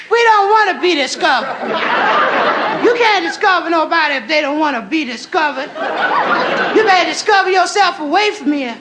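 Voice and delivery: sass the stranger off the beach; sassy girl. Acted. Girl on the beach challenging Chris, high sassy voice.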